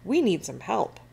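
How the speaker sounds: plain reading voice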